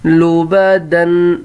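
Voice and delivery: لبدن stretched out, lu-ba-dun; slow model reading. Shown as a demonstration. Teacher to pupils, drawn-out model word. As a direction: sound out لبدن slowly. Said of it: "اگلا word".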